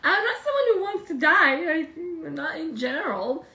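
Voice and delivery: laughing while talking. Real, not acted. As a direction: laugh through the words. Laughing at own dark joke.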